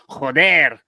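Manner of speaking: hammed-up Mexican Spanish joder; Mexican accent. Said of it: "I'm doing a Speedy Gonzalez accent".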